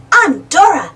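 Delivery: usual speaking voice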